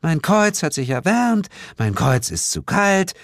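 high, exaggerated parody; theatrical mockery. A narrator's reading. Sheila mocking the narrator's cross announcement, high and theatrical. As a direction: mimic his announcement in a silly high voice. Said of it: "während sie theatralisch mit den Händen wackelte".

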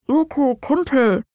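slow, calling from far away; a voice drifting from far off; distant voice. A narrator's reading. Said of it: "drang die Stimme wie aus weiter Ferne".

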